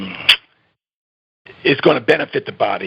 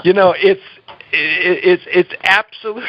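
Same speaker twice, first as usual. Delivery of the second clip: laughing while talking; 3 semitones higher than usual. Real, not acted. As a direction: try to talk through the giggles. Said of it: "I'm sorry for laughing".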